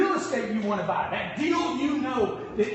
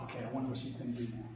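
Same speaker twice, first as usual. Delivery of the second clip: quiet; 10 semitones lower, 11 dB quieter, faster than usual. Retold or imitated. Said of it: "and I'm like".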